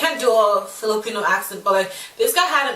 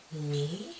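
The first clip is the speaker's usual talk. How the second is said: tiny, much softer questioning "me?"; shocked disbelief; 5 semitones lower, 13 dB quieter than usual. Retold or imitated. Graduate hearing own award called, hushed shock. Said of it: "I was so shocked".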